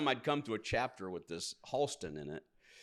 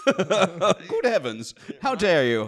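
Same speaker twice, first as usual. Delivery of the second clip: loud, very slow British outrage; posh British accent; 9 semitones higher, 14 dB louder, much slower than usual. Pretend. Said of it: "the British thing".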